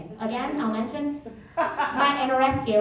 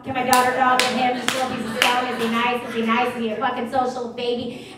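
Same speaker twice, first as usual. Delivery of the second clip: mocking her back. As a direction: parody the nosy mom to her face. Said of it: "I'll be like".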